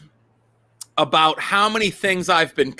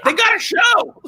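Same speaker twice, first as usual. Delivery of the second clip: excited shout. Real, not acted. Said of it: "excited exclamation".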